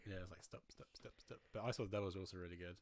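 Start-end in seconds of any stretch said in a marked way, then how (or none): 0.4-1.4 soft whispered begging, repeated; hushed, acted-out plea; whispered mock plea. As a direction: whisper it as if pleading with the screen.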